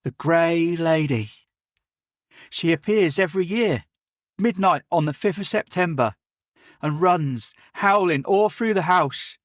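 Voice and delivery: put-on a keen boy apologising to his elder; eager servant boy Jim.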